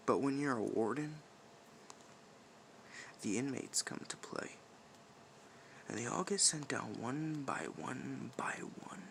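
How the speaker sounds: soft, breathy menace; sinister whisper. Acted. Warden warning of inmates sent down, sinister whisper. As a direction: let it creep out in a whisper.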